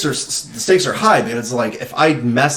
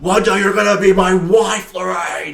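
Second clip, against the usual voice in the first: retold bully's yell; 7 semitones higher than usual. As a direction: holler it down the street as an impression. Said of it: "He yells that to her".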